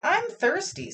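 high, tiny cartoon bean; squeaky bean voice. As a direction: squeak it like a little talking bean. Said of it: "said the bean".

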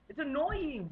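ordinary delivery

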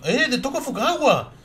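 high, loud, drawn-out cry of a name; excited shout. Acted. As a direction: call the name out high and slow, thrilled. Character cries out a name. (grito emocionado, agudo y lento)

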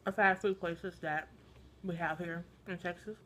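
muffled through fingers; talking behind hand; hand over mouth. Real, not acted. Seen 3: Hand covers mouth from about 2.1s, likely chewing after eating.

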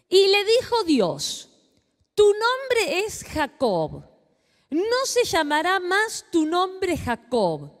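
preacher reading scripture slowly to the congregation. Acted. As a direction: read God's words slowly and weightily. Reads Genesis passage aloud.

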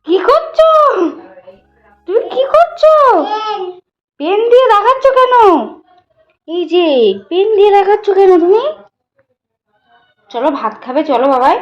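high-pitched coaxing. Real, not acted. Mother asking her son why he's drawing with a pen, very high loud coaxing. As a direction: coo and plead way up high.

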